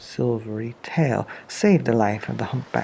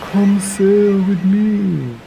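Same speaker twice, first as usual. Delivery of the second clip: slow, louder, booming whale voice; big kind whale; 8 semitones higher, 6 dB louder, much slower than usual. Acted. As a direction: invite her aboard, slow and grand. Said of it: "and said to the snail".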